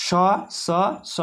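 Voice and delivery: স স স as three crisp separate s-sounds; sound demo. Shown as a demonstration. Teacher demonstrating the s sounds to students. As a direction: hiss out স three times. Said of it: "মানে দন্তের স".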